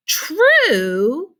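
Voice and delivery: high, loud startled "True?"; surprised cry. A narrator's reading. Old folks astonished at the boy's question, a high surprised cry. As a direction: toss it back in disbelief. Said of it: "cried all four of them at once".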